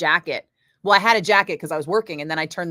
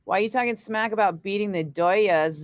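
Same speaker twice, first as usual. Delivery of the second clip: normal talking voice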